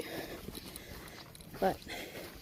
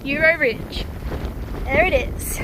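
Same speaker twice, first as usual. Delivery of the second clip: raised, higher voice over wind; shouting over wind; 6 semitones higher, 3 dB louder than usual. Real, not acted. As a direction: call it out against the gusts. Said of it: "If you can hear me over the wind".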